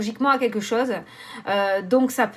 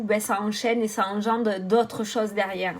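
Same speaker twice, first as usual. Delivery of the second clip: ordinary delivery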